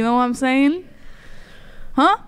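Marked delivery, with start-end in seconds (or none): none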